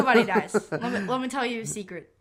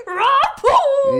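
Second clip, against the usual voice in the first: very high, loud imitated roar and crash; mock dinosaur ram noise; 12 dB louder than usual. Mimicking the dinosaur ramming people, high loud mock roar. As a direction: blurt out a cartoonish charging-dinosaur noise.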